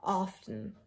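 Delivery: Often sounded out carefully, softly; pronunciation demo. Shown as a demonstration. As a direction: say Often slightly apart, quietly. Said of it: "There is no T".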